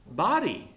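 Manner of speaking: "Body." punched out high and loud as the answer; emphasis on body. Shown as a demonstration. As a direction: land hard on "Body." as the key word. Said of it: "and Paul's emphasis is on what?".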